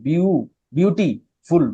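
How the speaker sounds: "বিউ-, বিউটিফুল" slowly split into its parts; teacher slowly sounding out "বিউটিফুল" in chunks; syllable by syllable. Shown as a demonstration. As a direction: stretch and separate the syllables of "বিউটিফুল". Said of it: "একের অধিক".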